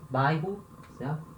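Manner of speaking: ordinary talk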